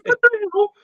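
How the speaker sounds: high-pitched retold alarm; bewildered yelp, voice way up; freaking out. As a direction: squeal it in mock disbelief. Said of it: "I'm freaking out".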